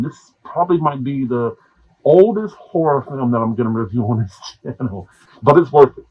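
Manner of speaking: ordinary talk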